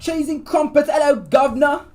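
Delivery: loud, high-pitched Cockney-style British caricature; over-the-top British street-crier greeting. Shown as a demonstration. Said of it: "best non-stereotypic British accent".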